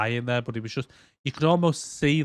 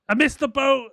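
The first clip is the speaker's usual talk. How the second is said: mock character voice; 13 semitones higher, 4 dB louder than usual. Mocking game character line.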